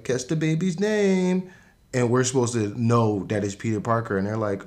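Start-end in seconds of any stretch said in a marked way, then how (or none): 0.0-1.5 high, slow, teasing sing-song; playful mother. Retold or imitated. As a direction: draw it out like a teasing game. Mother teasing at baby shower.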